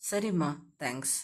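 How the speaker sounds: low, soft male voice; low male character, gentle thanks; young man's voice. A narrator's reading. Narrator voicing the male lead.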